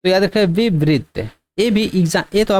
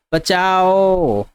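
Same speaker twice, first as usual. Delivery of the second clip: बचाओ with the final ओ stretched extremely long; drawn-out call for help; much slower than usual. Shown as a demonstration. Teacher showing students a stretched cry of बचाओ, very slow. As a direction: hold the last vowel of बचाओ as if calling for help. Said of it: "चिल्लाओ".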